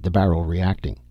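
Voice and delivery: plain reading voice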